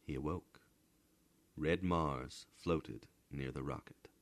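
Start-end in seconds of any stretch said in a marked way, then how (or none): none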